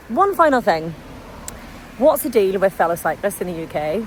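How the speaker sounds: ordinary talk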